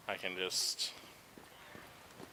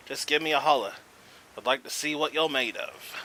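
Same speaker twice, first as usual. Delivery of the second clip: loud, higher, hammed-up Aussie fisherman; over-the-top Australian twang, booming and high; Australian accent; 4 semitones higher, 7 dB louder than usual. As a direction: play up a cheery Australian angler greeting the hunter. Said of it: "I don't know why I made him Australian".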